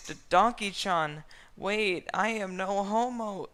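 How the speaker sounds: high, loud flustered pleading; hammed-up anime flustered voice. A narrator's reading. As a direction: stammer out a nervous denial. Flustered Shrek.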